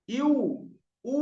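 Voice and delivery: "ইউ", "উ", each ending on rounded lips; wao after hamza. Shown as a demonstration. Teacher shows students wao endings, rounded "ইউ", "উ". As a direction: glide each of "ইউ", "উ" into w. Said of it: "এইরকম ভাবে".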